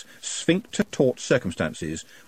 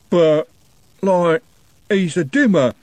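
high, loud, thick-witted voice of a dim lout; a slow lad blurting an excuse; 8 semitones higher, 6 dB louder, slower than usual. A narrator's reading. Dim Algernon excusing himself to furious Trev.

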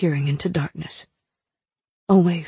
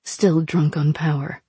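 ordinary delivery

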